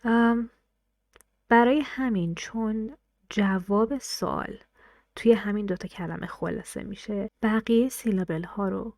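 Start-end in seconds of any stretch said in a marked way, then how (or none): none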